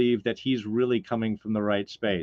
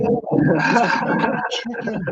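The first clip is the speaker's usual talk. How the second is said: slow breathy whisper, high; 9 semitones higher, 4 dB louder than usual. Retold or imitated. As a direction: drop to a slow hushed whisper. Repeats the quote, whispered.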